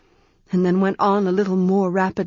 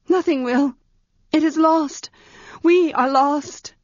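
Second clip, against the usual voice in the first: despairing; 8 semitones higher than usual. Acted. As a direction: give up, lost in fear. Girl despairs in the dark.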